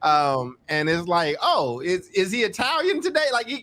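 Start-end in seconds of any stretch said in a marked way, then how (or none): 1.4-3.6 playful teasing. Real, not acted. Teasing about Omar's travels.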